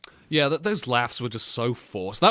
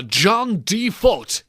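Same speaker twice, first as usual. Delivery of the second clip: higher, slow drawn-out summons; game commander calling; 6 semitones higher, much slower than usual. Acted. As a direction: summon the hero by name, stretched out. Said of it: "the Call of Duty game saying".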